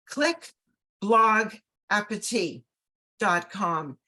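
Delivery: slowly, with pauses between the words. Shown as a demonstration. Host dictating a website address to her audience, very slowly. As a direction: spell out the address word by word, unhurried. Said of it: "I'll say it very slowly".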